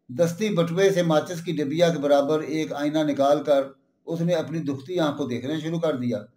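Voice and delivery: plain reading voice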